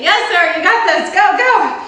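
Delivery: loud, high cheer building up. Pretend.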